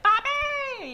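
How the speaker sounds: very high, sustained call; loud calling. Retold or imitated. Daughter to half-deaf dad, high loud calling. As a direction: call out to someone hard of hearing. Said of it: "it was really loud".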